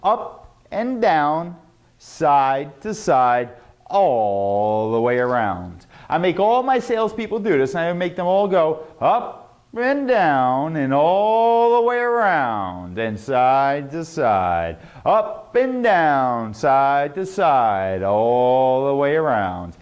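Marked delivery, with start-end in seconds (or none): 0.0-5.9 very slow, stretched-out words; exaggerated vocal drill. Shown as a demonstration. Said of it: "Write this exercise down". 9.0-19.8 very slow, swooping up and down; big, rolling, drawn-out words; over-exaggerated drill. Shown as a demonstration. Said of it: "a little over-exaggerated".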